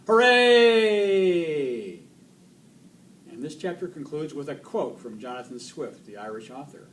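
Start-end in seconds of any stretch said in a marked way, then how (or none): 0.1-2.0 high, very loud, drawn-out cheer; shouted cheer. A narrator's reading. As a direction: cheer with the whole crowd.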